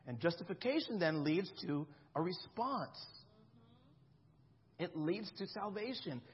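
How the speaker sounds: ordinary talk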